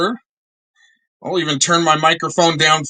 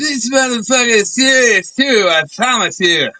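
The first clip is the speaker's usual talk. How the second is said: mocking shout; 8 semitones higher than usual. Co-host parroting the rival's clip back, mocking shout. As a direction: blast it back at him like a jeering echo.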